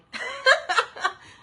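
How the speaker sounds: short burst of laughter, high and loud; sudden high laugh. Real, not acted. Laughs at own denial.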